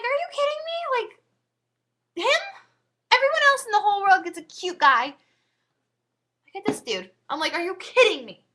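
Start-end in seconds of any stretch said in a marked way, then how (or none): 0.0-7.1 high-pitched retold indignation; exasperated disbelief. Retold disbelief at unwanted match. 7.5-8.4 high, loud retold protest; incredulous outburst, pitched up and louder; exasperated disbelief. Retold disbelief at unwanted match.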